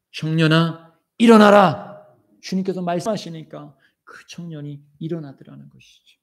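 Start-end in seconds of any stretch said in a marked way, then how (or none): 0.1-2.1 building command. Acted. Jesus at the coffin. ("청년아, 일어나라"를 크게 외치듯)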